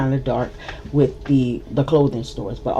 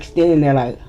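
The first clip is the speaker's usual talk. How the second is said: usual speaking voice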